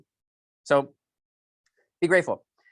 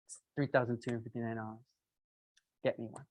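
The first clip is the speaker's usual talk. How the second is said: softer, low-key customer voicing the price; quiet customer voice; 3 semitones lower, 10 dB quieter than usual. Salesman voicing customer Laura buying, softly. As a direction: quietly imitate Laura deciding to buy.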